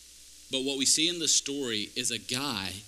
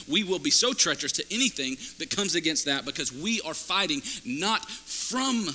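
loud, raised, driving sermon delivery; preaching at full force; 5 semitones higher, 5 dB louder than usual. Real, not acted. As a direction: build a rallying charge to the congregation.

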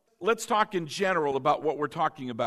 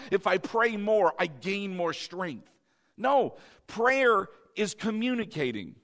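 ordinary talk

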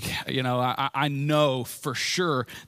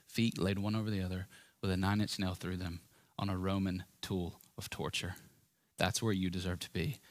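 hushed meditation; 5 semitones lower, 8 dB quieter than usual. Acted. Pastor guiding the congregation to picture the cross, hushed and much softer. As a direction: paint the scene in a near whisper.

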